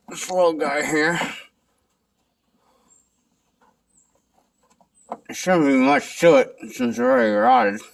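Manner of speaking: talking around a flashlight; words shaped around a held flashlight; flashlight in mouth. Real, not acted. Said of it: "I have the flashlight in my mouth".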